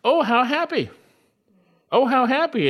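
high, emphatic cry of happiness; emphatic exclamation. Shown as a demonstration. Preacher quotes the inspired author's opening, exclaiming. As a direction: exclaim each phrase with lifted pitch. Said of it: "is actually emphatic".

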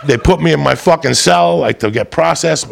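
ordinary talk, nothing marked

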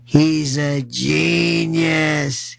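slow, low, dopey mock voice; mocking Republican voice. Mocking Mamdani, done as a slow low dopey sneer. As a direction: drag it out like a dim heckler. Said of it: "They keep mocking Mamdani".

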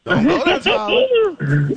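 high, loud teasing warning; mock warning shout. Warning co-host Tyler off Alabama, mock shout. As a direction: yell it at him like a playful threat.

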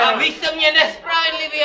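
loud, high protest; loud complaint. Pretend. Injured man accusing the instructor, loud protest. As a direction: shout the accusation.

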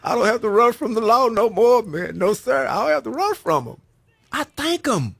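loud, high impassioned preaching; rising sermon fervour, building to a peak; preaching at full force. Acted. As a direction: preach it out to the whole church. Preaching to the congregation.

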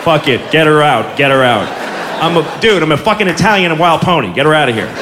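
a hint of a panicked pony; low mock pony voice. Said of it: "it's totally going".

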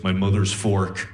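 low, deadpan sinister voice; creepy sleep-talker. Acted. Girlfriend answers in her sleep, low creepy voice. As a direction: imitate her, deep and dead serious. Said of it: "looks me dead in the eyes and goes".

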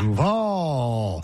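Duval, low start then stretched-out end; mock the drawn-out Duval; mispronounced Duval. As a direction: imitate Duval with a long scooped VAL. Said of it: "It's supposed to be a slant".

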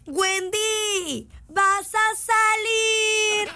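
high sing-song call, last word stretched out; llamado a gritos. Retold or imitated. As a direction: call a friend out to play, drawn out. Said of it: "y gritar". (llamado cantadito de niño, alargado)

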